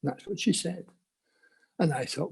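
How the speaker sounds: ordinary talk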